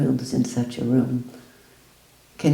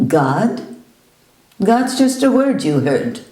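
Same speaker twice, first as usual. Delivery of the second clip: loud, higher scoff; dismissive retort; 5 semitones higher, 5 dB louder than usual. Retold or imitated. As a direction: brush the word God aside.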